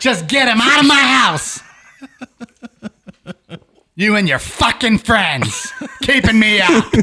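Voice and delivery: high, loud cranky granny; angry grandma. Pretend. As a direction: scold like a furious grandma. Grandma bit.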